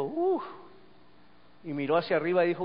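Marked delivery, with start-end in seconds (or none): 0.0-0.4 high-pitched "uf" of a tiny plant; little sprout's exclamation. Acted. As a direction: let out a high little marvel. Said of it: "salió la plantica y dijo". (exclamación aguda de una plantita)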